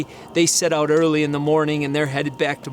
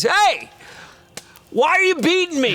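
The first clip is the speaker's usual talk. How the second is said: much higher, quicker donkey voice; talking donkey; 11 semitones higher than usual. Retold or imitated. Donkey pleading with Balaam, high and quick. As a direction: squeak it out as the hurt donkey. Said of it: "he begins to speak".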